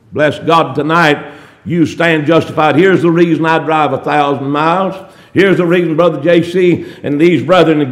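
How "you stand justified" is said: normal talking voice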